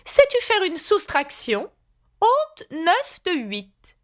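high, deliberate quizmaster queen; examining Red Queen. A narrator's reading. Red Queen testing Alice on subtraction, high commanding voice. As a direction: set the problem high, slowing on the sum.

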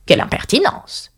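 exclaiming pudding. A narrator's reading. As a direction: protest as an affronted pudding. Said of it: "s'exclama le pudding". (pudding indigné qui s'exclame)

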